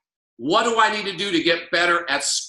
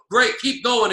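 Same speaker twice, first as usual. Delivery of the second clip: ordinary talk, nothing marked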